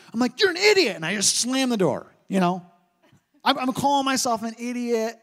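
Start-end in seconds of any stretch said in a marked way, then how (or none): 0.4-1.0 sharp self-scolding, higher and louder; angry outburst at own clumsiness; mock angry at self. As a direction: snap at yourself in frustration. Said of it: "I get so mad".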